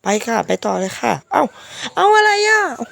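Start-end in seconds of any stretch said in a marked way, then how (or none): 1.9-2.9 shocked. Real, not acted. Dies again unexpectedly.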